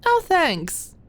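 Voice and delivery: put-on high, sweet teasing girl voice; Maud's high girly voice.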